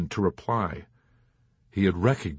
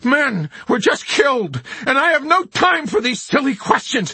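much higher, louder furious shout; blazing fury; 8 dB louder than usual. Acted. Chaplain on his feet against the officers, furious shout. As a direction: roar it, fists clenched. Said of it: "in a blazing fury".

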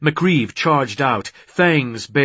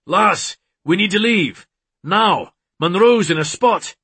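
high, urgent bellow; 7 semitones higher, 3 dB louder than usual. A narrator's reading. Man summoning his mate to leave, bellowing urgently. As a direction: call out urgently to someone elsewhere. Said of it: "hung up the phone and bellowed".

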